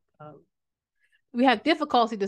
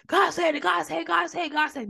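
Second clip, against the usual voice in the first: mock preacher chant, much higher pitched; noisy church talker; 7 semitones higher than usual. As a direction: imitate someone crying it out over and over in church. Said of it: "noise, da, da, da".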